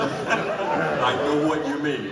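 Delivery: loud laughing protest; words squeezed out through loud laughter; laughing hard. Real, not acted. Both laughing loudly.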